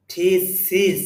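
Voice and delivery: থিসিস in two separated syllables, "tee-sis"; careful, split থিসিস ending "-sis"; corrected pronunciation. Shown as a demonstration. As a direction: model থিসিস with an i in the last syllable. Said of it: "সিজ হবে এখানে এটা".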